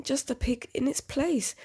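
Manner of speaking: ordinary delivery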